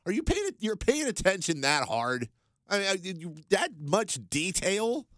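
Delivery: high, slow disbelief, each word stretched; incredulous. Retold or imitated. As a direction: scoff at how closely he checks. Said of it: "the other part of me is like".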